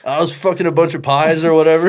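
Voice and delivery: loud, high mocking parody; mock the phrase, hammed up; mocking quote. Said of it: "Are you okay with me saying some".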